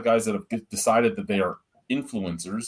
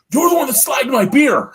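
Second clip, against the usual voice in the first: much higher, louder mock brewer voice; indignant brewer; 8 dB louder than usual. Brewer confronting the reviewer who slagged his beer, high mock voice. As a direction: squeak it out like an offended brewer.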